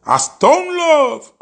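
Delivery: a long, slow, very high hype cry; drawn-out hype call. Real, not acted. Hyping Atlanta fans for Stone Love, drawn-out high call. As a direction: stretch it out like announcing the big sound system.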